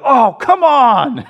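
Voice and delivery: a sports fan grumbling at the TV; dad moaning at a bad call; exasperated groan. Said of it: "I'll be like".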